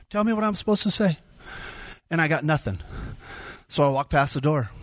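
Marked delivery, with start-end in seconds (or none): 0.1-1.2 higher, faster repeat demand; insistent arguing. Retold or imitated. Speaker fights with God in his mind, more insistent. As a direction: press the demand harder, pitched up and quick. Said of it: "started fighting with God in my mind".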